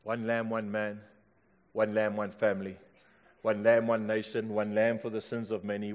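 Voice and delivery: low, soft, repeated sermon cadence; quiet preaching litany. Acted. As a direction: lay each line down gently.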